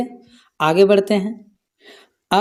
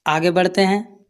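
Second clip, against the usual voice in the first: ordinary delivery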